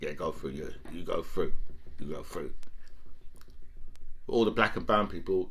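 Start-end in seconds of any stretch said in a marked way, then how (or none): 0.0-2.5 lower, softer, casual waving through; mock border guard. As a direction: wave them on like a bored guard.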